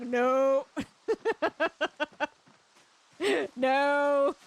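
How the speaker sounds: loud, laughing no; emphatic no. Retold or imitated. Refusing to finish the bait, a loud laughing no. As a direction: shut it down firmly. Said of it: "and I was like".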